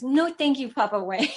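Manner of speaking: lightly voiced toddler repeating; imitating toddler. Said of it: "He goes".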